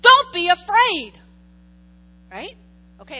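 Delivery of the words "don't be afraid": loud, sharp command; stern command. Acted. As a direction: bark it as an order. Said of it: "It's a command".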